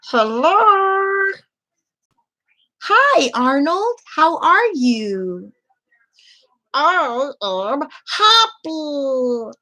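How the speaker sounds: high, slow puppet voice; squeaky puppet greeting a friend. Shown as a demonstration. Puppeteer showing puppet talking in front of mouth, high. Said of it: "put it in front of your mouth".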